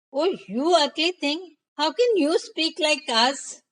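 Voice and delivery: high-pitched astonished princess; surprised. A narrator's reading. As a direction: react with haughty surprise at a talking creature. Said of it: "The princess was surprised".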